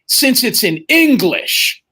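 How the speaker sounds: high, drawn-out teacher sarcasm; sarcastic teacher. Retold or imitated. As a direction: needle him with sarcasm. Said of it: "She goes".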